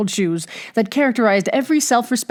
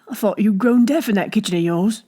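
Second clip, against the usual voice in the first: ordinary delivery, nothing marked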